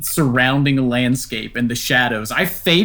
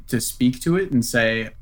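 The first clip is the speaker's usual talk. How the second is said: ordinary delivery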